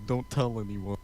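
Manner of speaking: usual speaking voice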